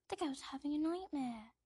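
put-on young Harry. Boy apologising to his aunt, small ashamed voice. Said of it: "says young Harry".

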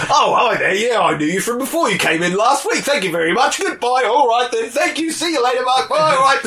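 high, loud put-on voice; hammed-up breezy shopkeeper; Wiseau flower shop scene. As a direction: rattle off cheery shop talk in character.

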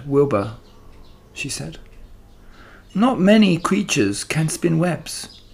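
patient spider advising the pig, kindly firm; wise spider Charlotte. Acted. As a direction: gently talk the pig out of it. Charlotte voice held.

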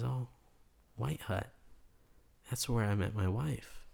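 soft, halting imitation of a stunned man; taken aback. Supermarket customer remembering his late wife, quietly taken aback. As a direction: let it out quietly, with pauses. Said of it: "is taken aback a little bit".

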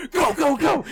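very high, loud rapid-fire urging; frantic mock shout. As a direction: imitate a panicked getaway yell. Acting panicked prankster urging driver.

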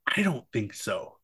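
softly drawn out, slow movie quote; lightly voiced kid character, slow and soft; imitating Kevin. Quoting Kevin's iconic moment, slow and soft. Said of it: "Kevin going".

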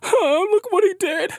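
high-pitched mock whining; whiny kid tattling. Media fussing over what he did, mock whiny. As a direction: tattle in a high, childish whine. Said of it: "start like whiny little kids".

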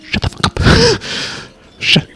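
sharp whispered scold; fierce whisper. Acted. Boris hushing Natasha about the rodent thing, fierce whisper. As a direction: spit it out under your breath.

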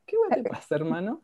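high, exasperated mock question; retold exasperation. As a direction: fire it back in disbelief. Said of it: "y yo así como".

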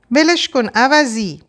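loud, slow, high yell; angry shout. A narrator's reading. The woman screams at the man dragging her sister, furious. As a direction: scream at him to let go. Narrator yells at abuser.

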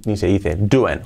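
normal talking voice

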